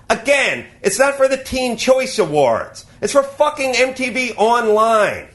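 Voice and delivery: ordinary talk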